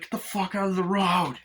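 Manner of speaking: high-pitched acted irritation; mock road rage; irate driver. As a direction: yell it like a driver passing by. Acts out driver yelling.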